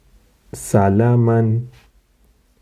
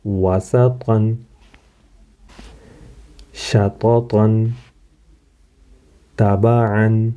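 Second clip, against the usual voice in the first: slow reading; much slower than usual. Shown as a demonstration. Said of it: "teacher reading word list slowly".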